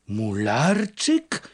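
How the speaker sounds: the name drawn out slowly in a rising astonished cry; astonished call. A narrator's reading. Old fisherman recognizing the returning farmhand, astonished call. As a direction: stretch the name out in disbelief. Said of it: "zawołał".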